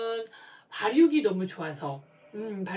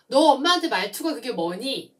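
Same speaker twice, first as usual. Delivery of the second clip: louder, higher mother's reprimand; mother scolding a child who talked back, loud and higher; mock angry scolding; 4 semitones higher, 8 dB louder than usual. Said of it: "싸움이 된 거예요".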